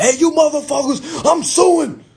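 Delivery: high, loud outburst; shouted legal threat, replayed for laughs; mock angry shout. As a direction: holler the threat way up high, hammed up. Acting out his earlier threats to sue.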